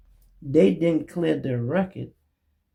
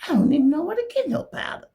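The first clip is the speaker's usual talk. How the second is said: higher, fast, fake-tearful whine; mock crying; 13 semitones higher, faster than usual. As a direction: whine it like a crybaby excuse. Mimicking his denial.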